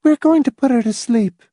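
high, level woman character voice; toneless. A narrator's reading. Miss Rice telling Hamilton her plan, toneless high voice. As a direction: say it tonelessly, held on one high note. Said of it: "she said tonelessly".

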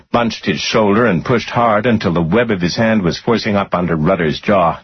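usual speaking voice

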